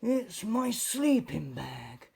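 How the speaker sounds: high, slow, small fox voice; a tearful little fox, drawn out; high sad fox voice. A narrator's reading. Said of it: "said the fox".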